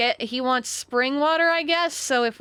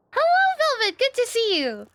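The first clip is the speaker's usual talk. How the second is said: much higher and louder, bubbly greeter; cheery NPC greeting; 7 dB louder than usual. Acted. A game character greeting the player, high and loud. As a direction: chirp it like an overjoyed townsperson.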